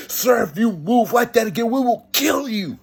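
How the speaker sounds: high, forceful warning; acted police threat. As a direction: imitate an officer issuing a final warning.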